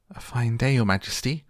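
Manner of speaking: ordinary delivery, nothing marked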